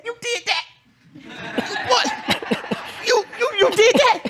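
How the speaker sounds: high, laughing disbelief; incredulous self-quote. Retold or imitated. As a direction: act out your own shock with a laugh. Mimics himself, laughing in disbelief.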